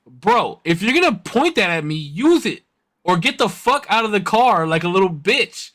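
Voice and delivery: high, taunting challenge; threatening. Retold or imitated. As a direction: dare him to use the mace. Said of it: "and I was like".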